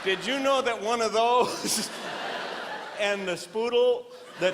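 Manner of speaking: real giggling through the words; can't hold back laughter; laughing. Real, not acted. Comedian cracks himself up.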